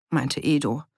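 usual speaking voice

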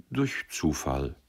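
slow, hesitant admission. A narrator's reading. Young man admitting chance, slow and hesitant. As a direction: drag it out, unsure of yourself. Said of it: "sagte er zögernd".

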